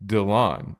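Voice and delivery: DYLAN said as "dih-LAHN"; Dylan drawn out to end in AHN; unusual name pronunciation. Retold or imitated. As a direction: say DYLAN stressed on the second syllable. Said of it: "I started calling him".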